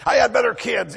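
loud, high grumbling complainer; a grumbling churchgoer playing the complainer; complainer's whine. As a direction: moan about everything you lack, loud and high. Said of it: "we become complainers".

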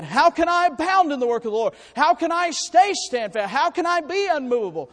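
loud, high preaching climax; building preaching. Acted. Preacher driving home the call to be steadfast, loud. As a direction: ramp it up to a big pulpit cry.

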